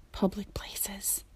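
hushed, slow whisper. Real, not acted. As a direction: whisper it like a secret. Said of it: "I don't know why I'm whispering that".

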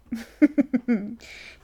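brief mischievous laugh; teasing laugh. Acted. Mommy laughing while teasing her partner, mischievous laugh. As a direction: laugh playfully at being a little mean.

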